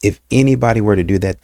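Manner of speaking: ordinary talk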